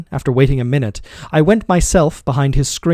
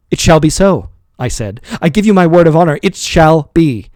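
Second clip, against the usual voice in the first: vehement vow; 4 semitones higher, 6 dB louder than usual. A narrator's reading. Master vowing to servant, loud and emphatic. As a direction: swear it, pressing each word.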